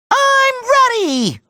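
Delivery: high, stretched-out excited cry; eager battle cry. Acted. Hero announcing he is ready, eager high cry.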